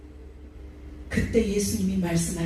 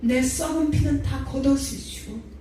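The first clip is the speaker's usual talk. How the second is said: high, pleading prayer, nearly sung; fervent prayer; 5 semitones higher than usual. Retold or imitated. Believer prays to the Lord to be cleansed, fervent. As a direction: lift the plea up toward God. Quoted prayer over the cup.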